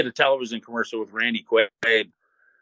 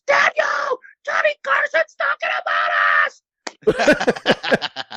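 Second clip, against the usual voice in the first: reenacted high-pitched excited scream; slower than usual. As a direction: scream it at the top of your lungs. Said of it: "I'm screaming at the top of my lungs".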